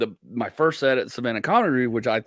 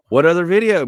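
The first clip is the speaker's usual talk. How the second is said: louder, higher incredulous retort; retold exasperation; 5 semitones higher, 8 dB louder than usual. Comic answering manager about a different video, exasperated. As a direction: throw the question back, loud and high.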